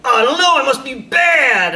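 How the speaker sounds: high, loud defeated cry; yelling back. Retold or imitated. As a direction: kid shouting back at mom that he must be bad. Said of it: "you're like".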